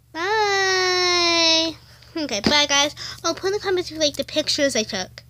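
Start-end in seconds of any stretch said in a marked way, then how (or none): 0.1-1.8 put-on little girl doll voice. Doll says goodbye.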